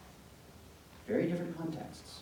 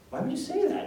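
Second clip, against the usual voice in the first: high, louder shocked question; upset; 6 dB louder than usual. Retold or imitated. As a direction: play a hurt sister demanding an answer. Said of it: "she got all upset".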